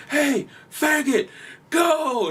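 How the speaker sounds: high, drawn-out mock shout; imitated driver's yell. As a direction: call out like an impatient driver behind someone. Acts skit driver yelling at red light.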